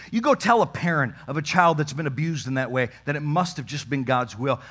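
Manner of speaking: normal talking voice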